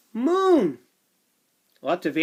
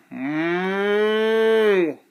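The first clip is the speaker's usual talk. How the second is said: cow moo. Acted. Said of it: "Cow calls".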